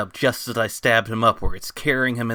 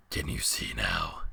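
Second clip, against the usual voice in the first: very low, distant beast voice; deep monster voice; 12 semitones lower, 9 dB quieter than usual. Acted. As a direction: speak as a huge ancient beast.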